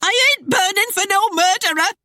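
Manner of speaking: very high, loud squealed yell; shouting back. A narrator's reading. Wife fleeing the house, shouting back at husband. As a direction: yell it shrieking as you flee. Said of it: "shouting back over her shoulder".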